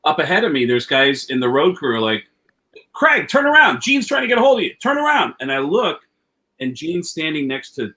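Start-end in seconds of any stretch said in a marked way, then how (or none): 2.9-5.3 high, raised retold shout; urgent calling out. Road crew calling Craig back down the hall, urgent calling out. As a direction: call out to turn someone around.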